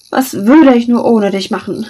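loud, hammed-up sighing question; theatrical sigh. A narrator's reading. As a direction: overplay the despair for show. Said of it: "seufzte Scar theatralisch".

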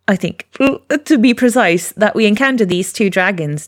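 ordinary talk

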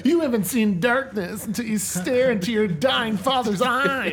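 high, loud mock-tragic declaration; hammed-up melodrama. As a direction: play the tortured teen, hammed up. Acted tragic one-upping in beach-movie bit.

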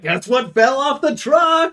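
high, slow sly dealer voice; hammed-up sleazy salesman pitch; shady guy voice. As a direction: sell it like a shifty guy with hot goods. Liquor store dude shows up.

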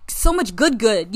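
ordinary talk

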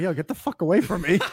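laughing, loud mock brush-off; mock angry stranger. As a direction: shove the weirdo away, half laughing. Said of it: "I'd have people be like".

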